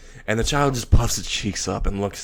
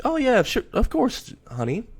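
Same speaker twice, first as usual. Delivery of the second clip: much higher, sing-song yes; sweet agreement; 13 semitones higher than usual. Acted. Millie sweetly letting Moxie hold the baby. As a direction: coo it sweetly.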